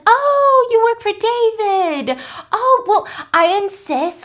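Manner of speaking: very high, slow, sweet put-on voice; syrupy socialite pushing coffee; sugary insistence. Adele insisting to her husband's secretary, sugary.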